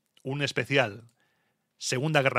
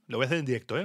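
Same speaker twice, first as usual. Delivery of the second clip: usual speaking voice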